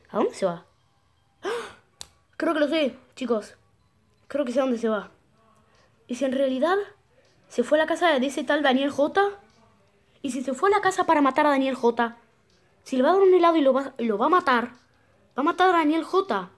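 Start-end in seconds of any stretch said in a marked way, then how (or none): 1.4-1.8 high, sudden gasp; gasp of realization. Real, not acted. Gamer working out where villain went, startled gasp. 2.4-16.5 loud, high, excited theorizing; alarmed realization. Real, not acted. Gamer realizes villain will kill Daniel, loud and agitated.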